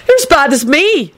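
high-pitched angry accusation. A narrator's reading. Neville accusing Lupin, angry. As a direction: accuse him angrily. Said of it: "Neville said angrily".

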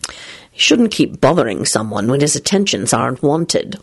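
low, superior reproof; lofty. A narrator's reading. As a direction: look down your nose as you reprove. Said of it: "Lupin replied loftily".

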